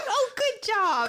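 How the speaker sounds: high, slow praising coo; talking to a toddler. Pretend. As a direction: cheer on a potty-trained toddler.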